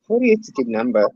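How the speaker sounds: New York accent slipping out on "number". Real, not acted. Commenting on a birthday age, New York accent. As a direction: let "number" slip into New York, dropping the r. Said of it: "heard my New York accent come out".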